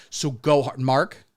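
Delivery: ordinary delivery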